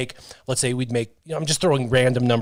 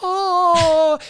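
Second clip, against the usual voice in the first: mock swoon. Imitating everybody swooning.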